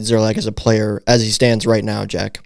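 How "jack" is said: ordinary talk, nothing marked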